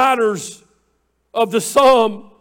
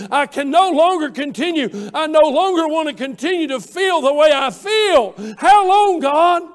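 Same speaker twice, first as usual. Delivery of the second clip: climbing higher and louder until the cry breaks into a shout; building to a shout; 5 semitones higher, 3 dB louder than usual. Acted. Preacher voicing David's despair to God, climbing to a shouted cry. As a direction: build the plea until it bursts.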